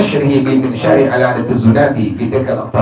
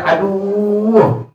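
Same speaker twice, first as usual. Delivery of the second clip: a light, high "Aduh" of pain; mock cry of pain; 6 semitones higher than usual. Pious man under the saw cries out in pain. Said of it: "Dia langsung teriak".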